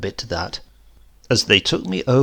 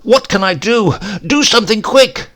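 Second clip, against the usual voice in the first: high, loud panicked thoughts; frantic inner yell; panicked cry; 10 semitones higher, 9 dB louder than usual. Acted. Panicked inner cry while falling.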